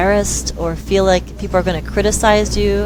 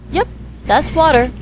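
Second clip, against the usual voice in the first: playing dumb; 8 semitones higher than usual. Mom playing dumb to son.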